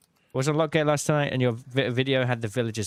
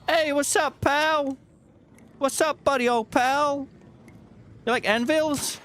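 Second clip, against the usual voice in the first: overly familiar; 14 semitones higher, 3 dB louder than usual. Acted. Greeting a game creature chummily.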